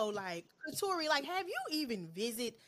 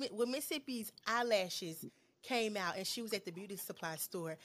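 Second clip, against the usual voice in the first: ordinary talk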